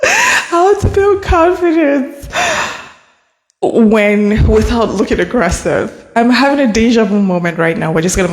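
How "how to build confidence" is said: high, slow, tearful laugh; tearful laughter restarting the topic; crying laughter. Real, not acted. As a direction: laugh till it sounds like crying. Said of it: "I'm sorry".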